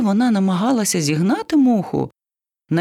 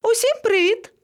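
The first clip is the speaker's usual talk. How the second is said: very high singsong hello; cheery greeting. Acted. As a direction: call down brightly to everyone. Monkey calls down from tree. (веселе привітання високим голосом)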